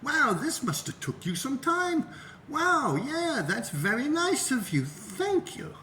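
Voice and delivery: high, slow, overly grateful voice; gushing thanks. Retold or imitated. Thanking the guy for the video, high and gushing. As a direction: gush your thanks, way up high. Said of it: "I'm like".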